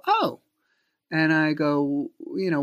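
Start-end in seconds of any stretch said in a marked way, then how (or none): 0.1-0.4 mock surprised oh. Reacting to kids' news.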